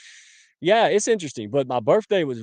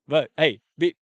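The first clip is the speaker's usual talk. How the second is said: normal talking voice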